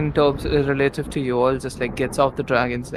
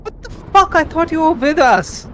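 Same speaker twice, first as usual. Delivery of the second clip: betrayed yelling; 12 semitones higher than usual. Acted. Game master role-playing a betrayed character at the party, yelling. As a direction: shout in high-pitched disbelief at a traitor. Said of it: "starts yelling and just goes like".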